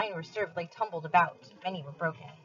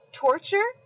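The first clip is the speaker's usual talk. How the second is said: high, loud, rising shock; shocked cry; 13 semitones higher, 5 dB louder than usual. A narrator's reading. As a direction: cry out in horror. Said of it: "I cried".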